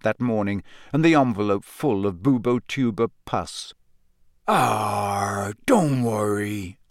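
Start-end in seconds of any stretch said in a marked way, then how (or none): 4.5-6.8 gentle. A narrator's reading. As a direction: soothe her gently like a big giant. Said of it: "said Hagrid gently".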